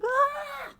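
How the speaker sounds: mock gasp. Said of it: "everybody's like".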